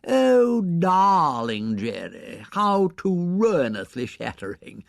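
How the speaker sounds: put-on high, slow, gushing society girl; drawn-out fashionable fuss over the patient; gushing sympathy. A narrator's reading. Young woman gushing over the injured Viscount, high and slow. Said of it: "who advanced crying sympathetically".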